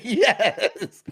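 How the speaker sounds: high, loud, laughing "yes"; excited cheer. Retold or imitated. Retelling winning the pool, laughing excited cheer. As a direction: squeal it out through a laugh.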